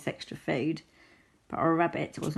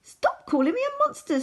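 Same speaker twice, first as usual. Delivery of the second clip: high, offended outburst; storybook character's indignant retort; indignant protest; 4 dB louder than usual. Acted. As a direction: object loudly up high. Castor protests at Rabbit's insult.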